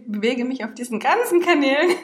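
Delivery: normal talking voice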